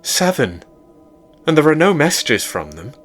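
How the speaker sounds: worried, asking about friends; lightly voiced worried girlfriend; April voice. A narrator's reading.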